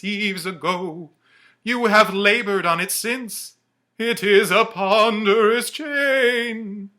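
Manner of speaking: high, loud, slow ghostly wail; Marley's ghost. Acted. The ghost tells Scrooge of his chain, wailing high and loud. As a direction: moan each word like a chained spirit.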